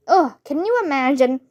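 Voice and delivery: plain reading voice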